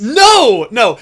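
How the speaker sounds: loud, high burst of "no"; emphatic denial. Real, not acted. Storyteller rejecting co-host's performance-art guess, loud and emphatic. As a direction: shoot the idea down loudly.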